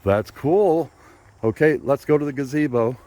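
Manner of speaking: high, loud delighted wonder. Real, not acted. Grandma praising granddaughter's invention.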